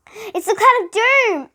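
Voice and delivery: high, loud, slow cry of alarm; alarmed exclamation. A narrator's reading. Girl seeing Dana's stuck face, alarmed exclamation. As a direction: announce the doom like a dreadful discovery. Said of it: "exclaimed Jenny".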